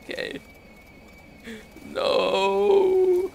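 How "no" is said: high anguished cry of no. Real, not acted. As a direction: cry out in despair.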